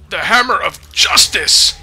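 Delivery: loud, slow battle cry; hammed-up hero shout. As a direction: proclaim it like a superhero.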